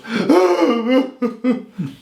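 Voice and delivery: high mock sobbing wail; exaggerated high-pitched crying noise; imitated deep crying. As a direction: imitate an old woman bawling. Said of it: "she cries so much and so deep".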